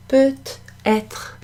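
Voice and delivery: "Peut-être" split in two, high-pitched; broken into syllables. Shown as a demonstration. Teacher letting learners hear the T, "Peut-être" broken into syllables. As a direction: say "Peut-être" with a gap before "être". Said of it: "you can hear that T".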